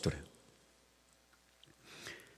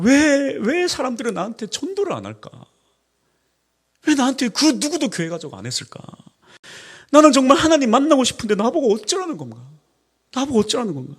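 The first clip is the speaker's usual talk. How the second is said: anguished pleading; 8 semitones higher, 4 dB louder than usual. Retold or imitated. Singer's anguished inner cry.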